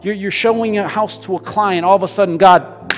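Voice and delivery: fiery preaching. Acted. Pastor paints a dramatic scene for his church, preaching hard. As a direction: drive it home to the congregation.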